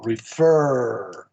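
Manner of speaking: "Refer" with a long, drawn-out FER; stress on second syllable. Shown as a demonstration. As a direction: lean hard on FER in "Refer" and hold it, falling. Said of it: "This is the second syllable".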